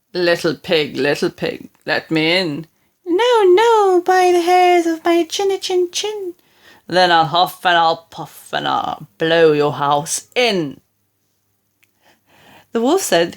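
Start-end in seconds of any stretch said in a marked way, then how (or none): 0.1-2.7 lightly voiced wolf at the door; a hint of the big bad wolf. 3.1-6.3 higher, louder squeaky pig; high piggy voice; little pig voice. Acted. 6.9-10.8 low menacing wolf. Acted.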